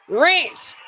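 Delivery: high squealed shout-out; excited name call. Real, not acted. Calling out the donor's name.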